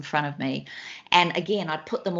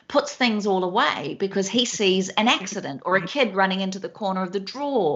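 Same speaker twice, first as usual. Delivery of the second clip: ordinary delivery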